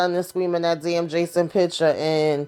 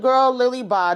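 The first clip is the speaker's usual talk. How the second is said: dismissive scoff; 7 semitones higher, 4 dB louder than usual. Real, not acted. Speaker scoffs at Lily's plea.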